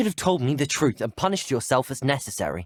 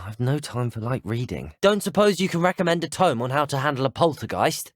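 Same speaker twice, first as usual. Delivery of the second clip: ordinary delivery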